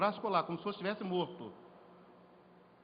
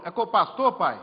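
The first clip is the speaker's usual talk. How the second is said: put-on child's question; 12 dB louder than usual. Toddler voiced asking father.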